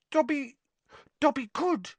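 high, hesitant elf; start, stop, then venture it in a squeak; unsure little house-elf; doubtful. A narrator's reading. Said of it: "he said doubtfully".

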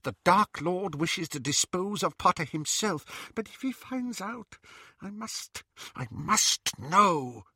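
high, anxious witch muttering; fretful witch. Acted. Bellatrix fretting over Potter, high anxious muttering. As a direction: worry it through to herself.

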